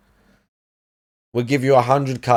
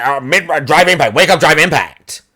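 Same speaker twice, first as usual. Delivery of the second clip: a mocking whiny rival, higher and louder; DSP moaning; 4 semitones higher, 4 dB louder than usual. Streamer mocking a rival's moaning in a higher whine. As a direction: moan it like a sore loser. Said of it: "watch D S P moan and say".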